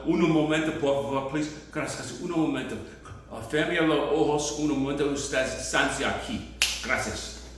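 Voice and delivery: normal talking voice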